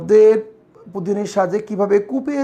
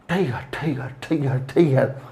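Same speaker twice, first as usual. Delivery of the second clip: cinema hall reverb; 7 semitones lower, 4 dB quieter than usual. Shown as a demonstration. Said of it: "এগুলো, এগুলো অনুরণন".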